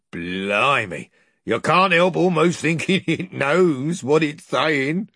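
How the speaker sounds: chuckling rough slaver, amused; laughing cockney slaver. Acted. Slaver mocking the mouse, laughing. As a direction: laugh through the line like a coarse dealer.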